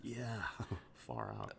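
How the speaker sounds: a spaced-out skater chuckles; stoner laugh. Acted.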